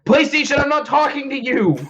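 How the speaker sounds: high, loud yell at the console. Real, not acted. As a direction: shout it at the PlayStation. Yelling at console reacting.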